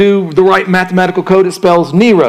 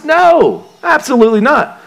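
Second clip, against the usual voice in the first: emphatic no; 8 semitones higher than usual. Real, not acted. Emphatic rejection of social media.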